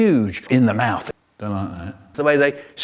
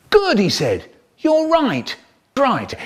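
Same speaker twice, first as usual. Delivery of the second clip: much higher, pleased inspector voice; 10 semitones higher, slower than usual. Acted. Inspector pleased with the class, voiced high. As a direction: pipe up like a delighted inspector.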